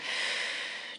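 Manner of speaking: long, breathy blown-out sigh; audible emptying breath; long exhale. Shown as a demonstration. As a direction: blow the air all the way out. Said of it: "just blow out all the stale".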